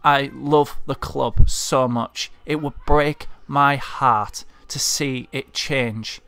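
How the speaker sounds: normal talking voice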